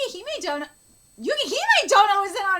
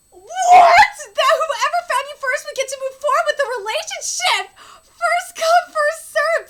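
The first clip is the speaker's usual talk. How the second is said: loud, incredulous re-reading; shocked outburst; 7 semitones higher, 10 dB louder than usual. Real, not acted. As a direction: blurt it out in disbelief, louder. Shocked re-reading of revealed deal.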